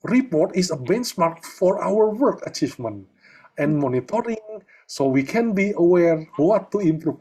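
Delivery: slow, high, stiff formal English report voice; formal English reading. Shown as a demonstration. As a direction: read it out like a stiff official statement. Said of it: "Waduh, Bro, bahasa Jawa aja".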